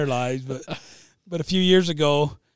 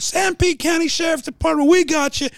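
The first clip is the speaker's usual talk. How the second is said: high-pitched pretend deputy; excited, hammed-up lawman voice; mock sheriff arrest; 13 semitones higher, 4 dB louder than usual. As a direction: call out a fake arrest. Prank arrest of the lady retold.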